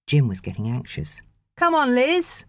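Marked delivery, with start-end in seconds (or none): 1.6-2.4 impatient call. A narrator's reading. Anxious husband calls out.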